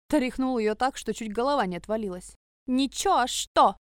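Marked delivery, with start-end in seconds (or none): 2.7-3.8 tipsy, giggling; drunk giggly. Acted. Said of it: "Пьяно хихикнула".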